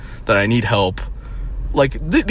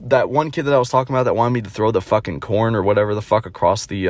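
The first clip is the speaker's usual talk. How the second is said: normal talking voice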